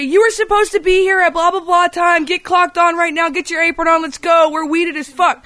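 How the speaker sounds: high-pitched mock yelling of orders; retold kitchen hollering; imitated screaming. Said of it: "they're screaming at me".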